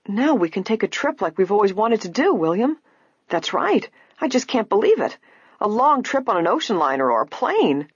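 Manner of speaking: high, excited chatter; delighted. A narrator's reading. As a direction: burst out with happy disbelief. Matthews brothers delighted at inheritance.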